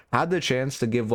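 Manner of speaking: normal talking voice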